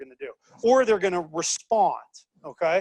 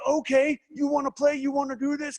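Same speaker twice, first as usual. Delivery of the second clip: higher, taunting you-wanna-play; mock challenge; 6 semitones higher than usual. Teacher voicing Audi daring BMW, mock taunting. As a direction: dare them to play. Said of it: "Audi is like".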